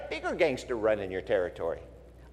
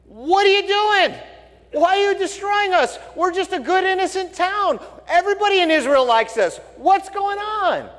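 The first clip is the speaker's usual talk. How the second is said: pleading woman; 5 dB louder than usual. Retold or imitated. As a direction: beg the commander in a high woman's voice.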